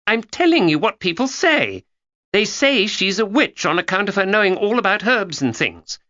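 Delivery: put-on a know-it-all girl confiding; young Georgia. A narrator's reading.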